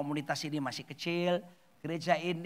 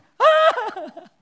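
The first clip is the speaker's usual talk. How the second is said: very high, loud mock bawl; imitated louder crying; 13 dB louder than usual. Widow crying harder at her friends' words, mock wail. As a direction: erupt in hammed-up wailing. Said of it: "malah tambah nangis".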